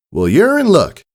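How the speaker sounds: cheerful country pony telling good news; friendly farm mare. Acted. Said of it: "Applejack said".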